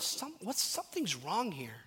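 soft, halting, puzzled aside; thinking to oneself. Acted. Believer privately noticing someone's lack of growth, soft musing. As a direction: voice a private doubt under your breath. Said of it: "and you think to yourself".

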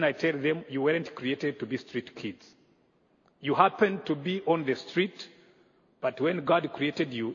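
ordinary delivery

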